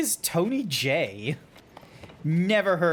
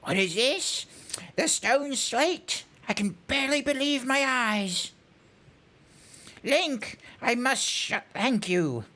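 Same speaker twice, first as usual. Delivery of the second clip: put-on higher, theatrical old scholar with teeth stuck out; weary character voice, amazed; 4 semitones higher than usual. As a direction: marvel grandly, then recite the verse slurred. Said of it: "the voice that I was able to come up with".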